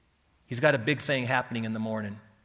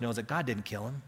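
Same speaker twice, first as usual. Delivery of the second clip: ordinary delivery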